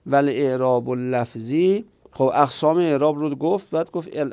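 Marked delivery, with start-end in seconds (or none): none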